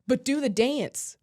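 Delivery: loud, slow, drawn-out mock pleading; demanding fans. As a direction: imitate a crowd nagging for the same trick. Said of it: "everybody's like".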